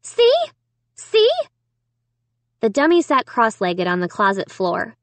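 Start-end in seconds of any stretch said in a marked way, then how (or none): 0.0-1.3 high, loud, insistent cries; triumphant. A narrator's reading. Girl pointing at Slappy for parents, triumphant. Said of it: "I pointed triumphantly at Slappy".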